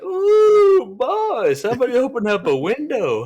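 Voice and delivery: high, loud mock sing-song exclaim; imitating the homeowner's sniffing alarm; customer lady's reaction. Homeowner teasing the plumber about the smell, high mock sing-song. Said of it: "And she's like".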